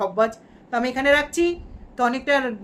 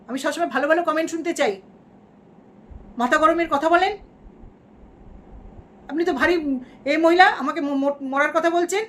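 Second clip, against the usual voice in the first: angry outburst; 5 semitones higher than usual. Pretend. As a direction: scold the commenter hotly. Angry at a commenter. (উঁচু গলায় রাগী ধমক)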